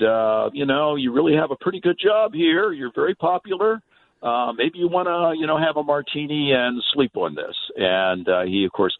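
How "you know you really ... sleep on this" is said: wife's advice in a higher voice; lightly voiced wife, pitched up and gently persuasive; quoting his wife. A hint of the wife urging Red to sleep on it, higher. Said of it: "she said".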